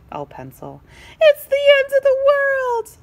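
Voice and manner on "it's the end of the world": loud, high wail of despair. Acted. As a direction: howl it like all is lost. Pencil wails in despair.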